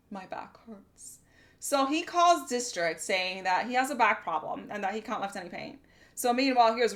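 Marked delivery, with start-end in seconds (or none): 0.1-1.2 soft, slow mock whine; lazy coworker mumbling an excuse, softer and slower; whiny coworker. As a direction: play the lazy guy pleading injury. Coworker voiced whining.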